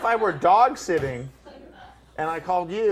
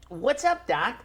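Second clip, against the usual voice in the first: high-pitched cartoon rabbit catchphrase; put-on cartoon rabbit voice; Bugs Bunny; 4 semitones higher, 3 dB quieter, much slower than usual. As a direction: start high, then drop on the last word. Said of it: "I'll do Bugs Bunny".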